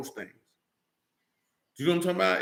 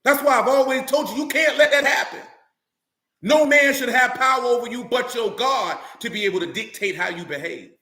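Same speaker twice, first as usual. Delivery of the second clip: higher, louder preaching that rises to full force; fiery preaching; 7 semitones higher, 4 dB louder than usual. Acted. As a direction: drive the point home from the pulpit.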